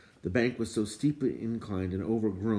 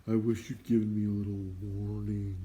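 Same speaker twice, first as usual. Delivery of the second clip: sad. A narrator's reading. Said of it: "a sad voice replied".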